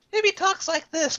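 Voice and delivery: high, slow, lightly cute cat voice. Retold or imitated. Showing how the cat could talk, high and slow. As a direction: purr it out a bit like a kitty. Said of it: "like a cat".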